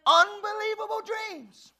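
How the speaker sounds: loud, high, slow, drawn out; preaching at full force. Real, not acted. Preacher thundering scripture to the congregation. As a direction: preach it with all you have.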